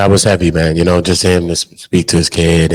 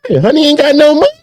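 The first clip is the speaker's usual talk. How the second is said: disbelieving squeal, much higher and louder; incredulous, high; 5 dB louder than usual. Real, not acted. Host hears money gossip, squeals high in disbelief. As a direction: play it up like you can't believe it.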